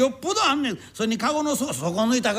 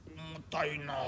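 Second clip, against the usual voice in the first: low, much softer, pressed out under the load; strained under weight; 6 semitones lower, 14 dB quieter, slower than usual. Acted. Bearer complains of the heavy load: low strained murmur. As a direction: groan it out while carrying.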